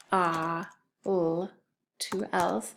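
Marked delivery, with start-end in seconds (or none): 0.1-1.5 F-a-l with a long f, "ah", held l; F-a-l, each sound held. Shown as a demonstration. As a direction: sound out F-a-l slowly. Teacher sounds out word for spelling.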